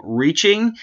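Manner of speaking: usual speaking voice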